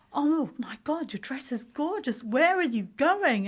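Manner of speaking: exaggerated impressed compliment; gushing admiration. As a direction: imitate friends gushing over a dress. People gushing over her dress.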